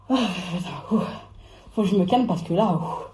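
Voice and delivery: low, subdued exasperation with puffs; worked up but holding it down; real irritation. Real, not acted. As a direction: huff and try to keep calm. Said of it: "je suis énervée".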